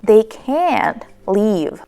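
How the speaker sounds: "can't" drawn out with breath, final t dropped; emphasized "can't". Shown as a demonstration. Teacher gives a negative example, stressed "can't". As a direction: stretch "can't", no released t. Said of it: "we emphasize it and not pronounce".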